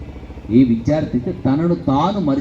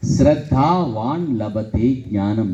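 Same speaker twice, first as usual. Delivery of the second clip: slow recitation; 7 semitones lower, much slower than usual. Shown as a demonstration. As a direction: chant the verse very slowly, word by word. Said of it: "చెప్పండి ఒకసారి". (నెమ్మదిగా శ్లోకం పఠనం)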